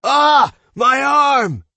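loud cry of pain; pained scream. A narrator's reading. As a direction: scream out in agony. Broken arm discovered.

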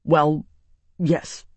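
halting, mildly miserable admission. A narrator's reading. Embarrassed nephew owns up to his aunt, miserable. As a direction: admit it reluctantly, with a pause. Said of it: "he said miserably".

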